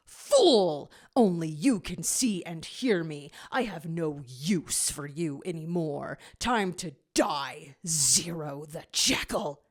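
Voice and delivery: loud sneering villain, then slow and low threat; menacing villain. Acted. Mephiles threatens Zero in null space with a slow menacing voice. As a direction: taunt your victim before the kill.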